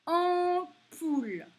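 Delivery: put-on teacher sounding out Ampoule for children; slow, sounded-out word. Shown as a demonstration. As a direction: stretch Ampoule so the en sound rings out. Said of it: "teacher sounding out a word".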